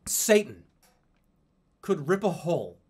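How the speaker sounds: normal talking voice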